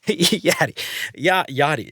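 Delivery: "Yaddy" with a flat "ya" and a tapped d, New Orleans style; Yat pronunciation. Shown as a demonstration. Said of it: "that's how I just pronounce Yaddy".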